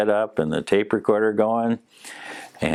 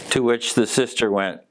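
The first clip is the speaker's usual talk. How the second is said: ordinary talk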